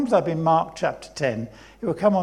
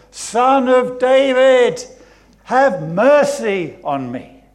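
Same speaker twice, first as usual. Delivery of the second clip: slow, drawn-out high shout; crying out louder; 8 semitones higher, 10 dB louder, slower than usual. Acted. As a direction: bellow the plea, refusing to be silenced. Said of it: "he cried out all the more".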